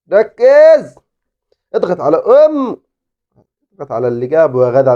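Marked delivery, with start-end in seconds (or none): 0.1-2.8 shouted order. Real, not acted. Gamer yelling at his footballer to focus.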